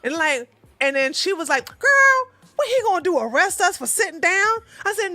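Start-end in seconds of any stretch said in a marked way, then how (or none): 1.7-4.6 friend's high, loud, bold challenge; sassy dare. Retold or imitated. Friend daring her to sit near the cop, high and loud. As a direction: pitch her up and toss it off boldly.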